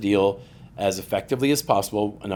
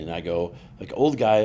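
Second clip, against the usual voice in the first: usual speaking voice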